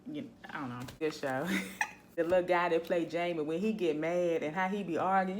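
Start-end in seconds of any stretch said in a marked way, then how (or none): none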